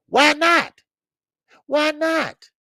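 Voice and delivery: high, loud, incredulous demand; indignant questioning. Real, not acted. Host questioning a mother's remark, indignant.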